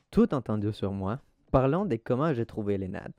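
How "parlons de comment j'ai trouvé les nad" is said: normal talking voice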